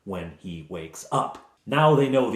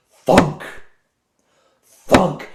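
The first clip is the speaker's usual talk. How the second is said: sharp, high, booming "thunk"; loud axe thunk; 7 semitones higher, 10 dB louder than usual. Acted. Game master imitating an axe hitting a wooden grate, loud thunks. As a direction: punch out each blow with a long pause between.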